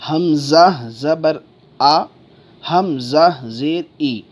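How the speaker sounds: slow, spaced "ہمزہ زبر آ، ہمزہ زیر ای"; teacher's measured model, pausing before "آ" and saying "ای" as ee; correct Arabic spelling-out. Shown as a demonstration. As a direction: spell it out slowly, ending "ای" on a pure long ee. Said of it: "تو یہ یاد رکھیں".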